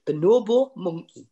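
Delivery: Geordie bonobo monkey, falling tune; Geordie accent. Retold or imitated. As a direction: mimic a Geordie saying it. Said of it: "when a Geordie goes".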